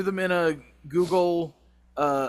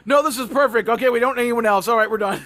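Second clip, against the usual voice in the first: excited; 7 semitones higher, 8 dB louder, faster than usual. Retold or imitated. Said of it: "Carrie basically said".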